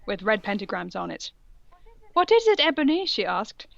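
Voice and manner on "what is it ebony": perky teacher voice. Acted. Voicing female teacher.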